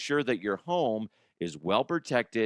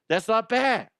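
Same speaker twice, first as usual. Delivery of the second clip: higher, louder impressed repeat; excited; 10 semitones higher, 4 dB louder than usual. Real, not acted. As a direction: say it again, more impressed. Repeated price reaction.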